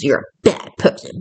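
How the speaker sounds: normal talking voice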